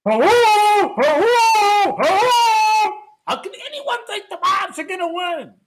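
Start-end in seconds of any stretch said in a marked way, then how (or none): 0.0-2.9 loud, drawn-out incredulous hello; exasperated loud hellos; mocking hello. Real, not acted. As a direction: call out as if nobody's listening. Mocking Mavs believers.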